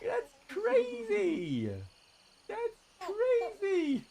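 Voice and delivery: very high, slow, drawn-out amazement; baby talk amazement. Acted. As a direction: stretch it out for a baby. Baby talk exaggerated wonder.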